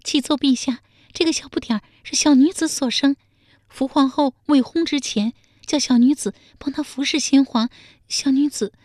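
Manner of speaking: high-pitched, anxious maid voice; nervous palace maid. A narrator's reading. Palace maid confessing to the emperor, nervous and faltering. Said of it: "宫女结结巴巴地说".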